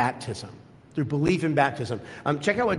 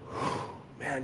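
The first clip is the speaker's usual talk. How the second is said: breathless whispered whew; 12 dB quieter than usual. Real, not acted. Moved by the invitation.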